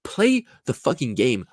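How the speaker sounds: normal talking voice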